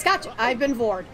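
usual speaking voice

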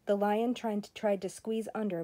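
plain reading voice

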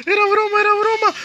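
high, frantic pleading squeal; panicked pleading. Real, not acted. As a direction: beg in panic. Begs monster, was just joking.

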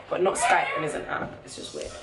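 usual speaking voice

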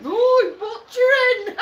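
high, loud drawn-out cry; retold outburst. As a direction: shout it out slowly, imitating an outburst. Retelling someone's outburst reply.

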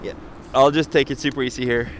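ordinary talk, nothing marked

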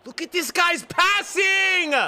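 high, loud, slow yell of disbelief; furious gamer shout; outraged shout. Real, not acted. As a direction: bellow at the screen. Outraged at opponent's passing.

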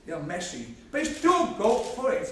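ordinary delivery

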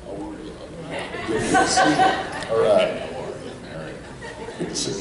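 soft, muttered greetings in passing; half-voiced impression of a star greeting people; Chris Farley. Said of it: "I see Chris Farley walking".

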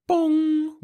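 loud, high ringing imitated gong; machine bong. A narrator's reading. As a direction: sound a loud alarm chime with the voice. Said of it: "went the Monitex".

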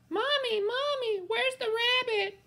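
put-on very high little kid asking mommy; small child voice. Child in audience calls out.

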